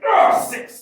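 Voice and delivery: sudden strained yell from effort; exertion shout. Real, not acted. Lifter straining under the weight, shouting. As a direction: yell it out mid-lift, high and loud.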